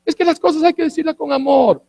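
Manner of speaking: high, sing-song mock sweetness; put-on, cloying plea for love. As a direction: mimic a pious objector in a high voice. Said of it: "solo el que habla despacito está bien".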